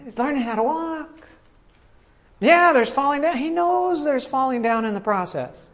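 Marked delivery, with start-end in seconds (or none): 0.0-1.3 voiced and high, pleased; a delighted God proudly announcing; proud announcement. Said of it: "he's like".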